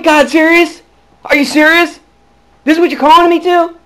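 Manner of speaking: furious at God. Retold or imitated. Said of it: "I was furious".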